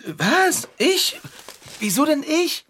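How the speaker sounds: high, startled protest; shocked protest. Acted. Conny protests being handed the ray gun. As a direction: squeak in disbelief.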